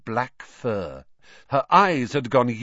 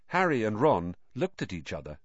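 usual speaking voice

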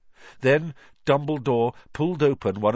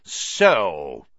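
cold; 7 dB louder than usual. A narrator's reading. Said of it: "his cold eyes".

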